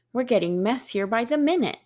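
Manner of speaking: plain reading voice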